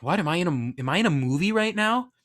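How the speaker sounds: retold incredulous reaction, rising to the end; amazed disbelief. Newcomer marvelling at the movie-like diner, amazed disbelief. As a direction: pitch climbing high in astonishment.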